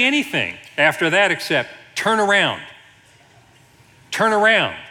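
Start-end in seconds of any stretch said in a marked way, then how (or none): 1.9-4.8 high, loud mock-impatient command; imitated navigation lady out of patience; testy GPS lady. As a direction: insist like a fed-up sat-nav. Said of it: "she gets a little testy".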